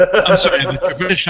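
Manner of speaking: laughing through a teasing apology. Real, not acted. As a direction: start out laughing, then tease. Laughing at a bad accent.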